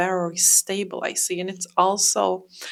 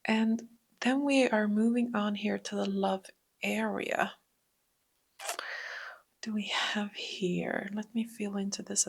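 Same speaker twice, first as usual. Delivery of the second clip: softer whispered transition; 8 dB quieter than usual. Real, not acted. Reader whispers while moving to the love reading. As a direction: hush the voice as you turn the card.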